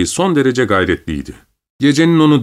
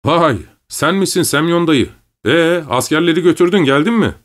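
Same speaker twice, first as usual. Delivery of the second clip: glad shout; 7 semitones higher than usual. A narrator's reading. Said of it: "sevinçle bağırdı".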